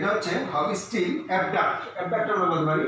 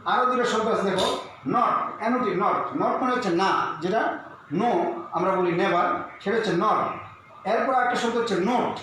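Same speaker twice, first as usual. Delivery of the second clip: usual speaking voice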